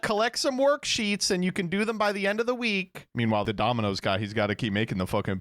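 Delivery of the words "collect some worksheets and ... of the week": mock boss announcement. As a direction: announce it like a smug manager. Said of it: "And they're like".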